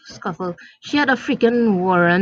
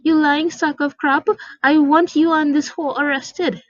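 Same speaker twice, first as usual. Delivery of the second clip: furious mother; 7 semitones higher than usual. Acted. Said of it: "E M gets furious".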